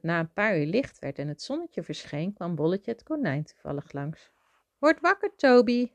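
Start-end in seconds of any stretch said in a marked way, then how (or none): 4.8-5.9 high, raised singing-out voice; wake-up call. A narrator's reading. Rabbit waking sleeping Toby, high wake-up call. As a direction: call brightly to rouse a sleeper.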